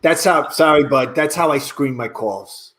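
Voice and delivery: low-pitched New Yorker on the phone; apologetic coach in a deep New York voice; New York dialect coach. Acted.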